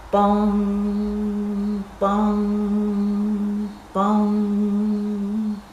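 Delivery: long ringing bong like a church bell. Acted. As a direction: let each bong hang like a big bell. Bell rings again, bird scared.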